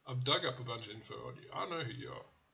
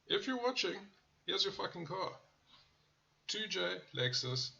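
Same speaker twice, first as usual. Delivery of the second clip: sarcastic callout; 6 semitones higher than usual. Real, not acted. Calls out rude viewer.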